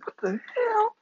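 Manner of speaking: very high, incredulous squeal; mock disbelief. Comic reacting to a scolded husband, high-pitched disbelief. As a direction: push it way up in put-on shock.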